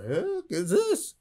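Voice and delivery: slow, baffled mock question; skeptical disbelief. Skeptical student baffled by teacher's words, slow disbelief. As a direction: drawl it out in disbelief.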